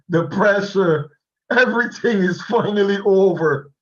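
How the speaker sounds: ordinary talk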